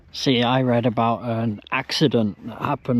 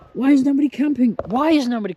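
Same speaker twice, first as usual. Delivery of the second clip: surprised; 11 semitones higher, 3 dB louder, faster than usual. Real, not acted. Amazed at empty spot.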